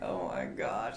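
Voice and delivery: low, soft retold groan of dismay; embarrassed aside. Wife replays being recognized at the salon, embarrassed. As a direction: drop low and quiet, as if mortified.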